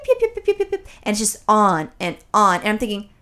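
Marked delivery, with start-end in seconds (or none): 0.1-0.9 high mocking yip yip; yappy teen girls. Mocking the chattering teen girls on the bus, squeaky high yips. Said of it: "these bitches are gonna freeze".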